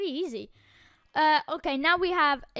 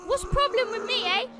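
normal talking voice

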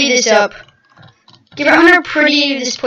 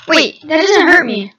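ordinary delivery

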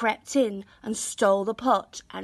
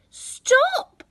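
much higher, louder panicked cry; greedy boy crying at the pot to stop; 6 dB louder than usual. A narrator's reading. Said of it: "cried the boy".

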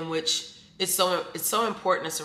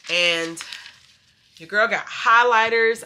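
normal talking voice

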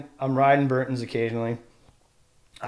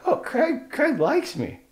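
delighted realisation; 12 semitones higher, slower than usual. Retold or imitated. Retold delight.

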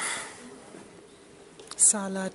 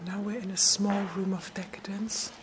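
whispering; 4 dB quieter than usual. Real, not acted. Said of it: "trying to be discreet".

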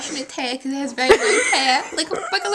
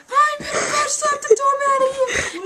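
much higher screaming threat; high yelled threat; 12 semitones higher than usual. Acted. Llama yells a threat.

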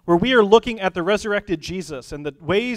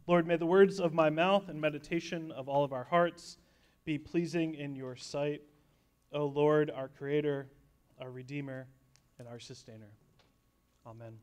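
soft, lowered prayer voice; 4 semitones lower, 9 dB quieter than usual. Real, not acted. Pastor opening the sermon with prayer, hushed and reverent. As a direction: pray quietly, fading gently toward the amen.

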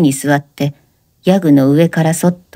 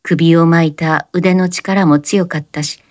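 plain reading voice

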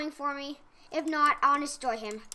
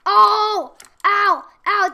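loud startled yelps of pain; 4 semitones higher, 12 dB louder, much slower than usual. Real, not acted. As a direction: yelp as you get hit. Getting hit in game.

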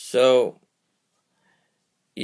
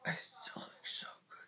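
whisper; 17 dB quieter than usual. Real, not acted. Aside under her breath.

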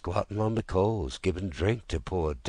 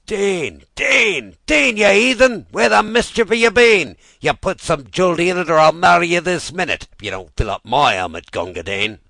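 loud, much higher scolding, rushing in the middle; angry shouting; 12 semitones higher, 13 dB louder than usual. Acted. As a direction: rail at him, fast and impatient. Soldiers scolding the water-carrier.

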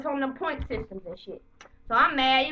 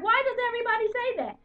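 woman's voice; 9 semitones higher, faster than usual. Retold or imitated. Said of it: "She's like".

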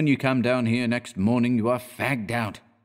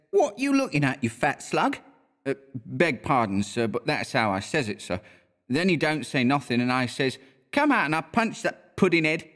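higher Cockney lad telling a tale; Cockney bellboy; 6 semitones higher than usual. A narrator's reading. Thomas tells Mister Scott about the watchman, in higher Cockney. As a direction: tell it like a young London bellboy.

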